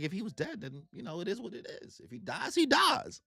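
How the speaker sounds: very soft, detached murmur; cool Zoro. Retold or imitated. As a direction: shrug it off quietly. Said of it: "Zoro's like".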